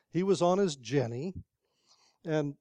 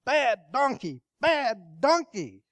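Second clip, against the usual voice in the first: scolding; 7 semitones higher, 5 dB louder, slower than usual. Acted. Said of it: "begins to beat the donkey again".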